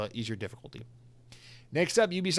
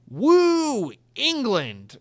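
loud, high whooping cheer; mock British cheer; 9 semitones higher, 9 dB louder, much slower than usual. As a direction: whoop it like a rowdy England fan. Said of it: "the comment reads simply".

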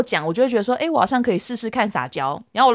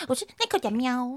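coquettish; 9 dB quieter than usual. Retold or imitated. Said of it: "我就这样撒娇".

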